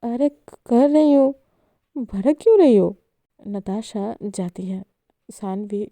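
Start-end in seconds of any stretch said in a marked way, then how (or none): 0.0-3.0 scared. Acted. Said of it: "डर के कहती है".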